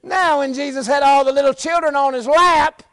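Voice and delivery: high, loud preacher's holler; full-force preaching. Real, not acted. Preacher contrasting Jesus' days to congregation, full-force shout. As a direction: belt it out to the whole church.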